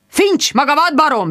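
high-pitched angry bawl; bellowing. A narrator's reading. Sir Hugo bawls at Finch on the phone. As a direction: roar the insult down the phone. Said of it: "bőgte".

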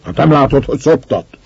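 loud, harsh rebuke at a pestering comrade; rough scolding. A narrator's reading. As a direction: snap at him to leave her be. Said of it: "durván szólt rá". (durva, hangos rendreutasítás)

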